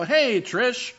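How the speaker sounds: "Hey, Trish" called high and bright; cheerful greeting. Retold or imitated. Greeting a wedding guest by name, high and eager. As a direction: greet a friend at a party. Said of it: "I go".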